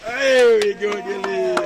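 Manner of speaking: loud, delighted praise for the elephant; excited cheer. Real, not acted. Handler cheering the elephant for getting up, loud and excited. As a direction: cheer her on at full volume.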